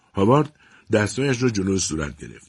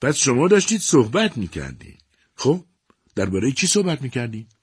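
higher, taunting and foolish; mother foolishly taunting her children; mildly mocking laugh in voice; 6 semitones higher, 3 dB louder than usual. A narrator's reading. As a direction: tease them with a hint of silly mirth.

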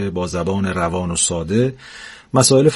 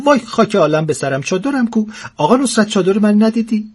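put-on much higher, panicky old woman's cry; flustered old woman; 11 semitones higher than usual. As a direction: scramble in a fluster for her chador. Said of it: "هول شده بود".

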